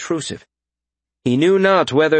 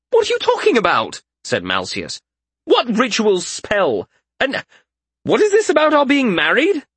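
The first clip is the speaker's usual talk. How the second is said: alarmed confusion; 11 semitones higher than usual. A narrator's reading. Malseus demands to know about the marriage, high and bewildered. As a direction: demand answers in disbelief.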